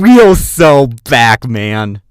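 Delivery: loud, drawn-out hype shout; too loud. Real, not acted. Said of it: "I talk too fast and loud".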